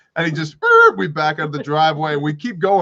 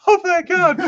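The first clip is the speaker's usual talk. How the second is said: high squeaky relief through laughter; mock relief cracking into laughter; laughing relief; 3 dB louder than usual.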